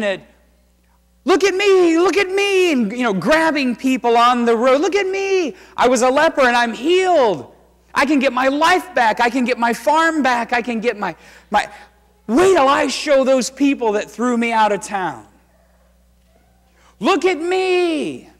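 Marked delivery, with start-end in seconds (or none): 1.3-2.7 high, loud, overjoyed cry of a healed man; ecstatic shouting. Acted. Healed lepers leaping and shouting. 4.8-18.3 high, loud, giddy bragging; excited boasting. Acted. Healed lepers shouting to people.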